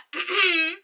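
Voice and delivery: loud mock throat-clear; pointed throat clearing. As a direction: answer with an exaggerated "ahem". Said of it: "my throat a little scratchy".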